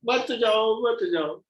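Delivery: mock tearful plea, starting high; imitating crying children. Grandchildren begging grandmother not to leave, mock crying. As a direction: plead like a small child, lightly acted. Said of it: "रोने लगे".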